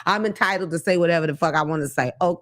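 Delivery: ordinary talk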